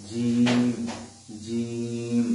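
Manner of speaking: জিম said from the middle of the tongue, firm; Arabic jim demo. Shown as a demonstration. Teacher demonstrating jim for students to copy. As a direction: drill জিম clearly. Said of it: "আমরা কয়েকবার চেষ্টা করি".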